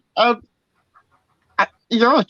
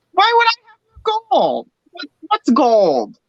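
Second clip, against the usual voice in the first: squeaky, much higher innocent act; 11 semitones higher than usual. Acted. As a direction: play dumb in a tiny voice. Kobold plays innocent.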